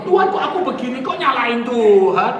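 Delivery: loud complaining plea, slowing at the end; mock whining prayer. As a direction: parody a grumbling prayer. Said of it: "Berdoa kok".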